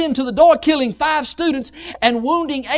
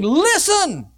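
high, urgent summons; ringing one-word cry; calling out; 5 semitones higher than usual. Acted. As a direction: call out like a war trumpet. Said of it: "He was calling out saying".

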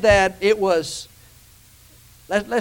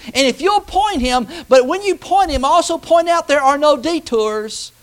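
higher, louder preaching with driving repetition; kazanie z mocą; 7 semitones higher, 6 dB louder than usual. Real, not acted. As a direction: preach it hard, stretching the key phrases. Preaches at full force.

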